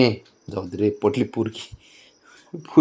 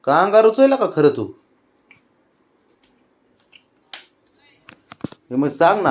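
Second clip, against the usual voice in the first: much higher, louder sing-song questions; 9 semitones higher, 6 dB louder than usual. Real, not acted. Grandmother offering toddler a plant to play, high sing-song.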